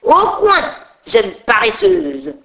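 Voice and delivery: high, loud scolding teacher voice; teacher scolding the late fairy, high and loud; stern schoolmistress. Acted. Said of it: "dit la maîtresse".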